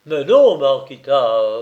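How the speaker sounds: loud, emphatic protest; hearty reassurance. Acted. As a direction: brush the worry away loudly. Guervet heartily reassures Marquita.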